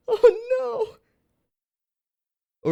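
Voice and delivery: very high, squeaky laughing lament; helpless laughter. Real, not acted. Creator mourning the deformed prop, laughing helplessly. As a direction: wail it through real laughter.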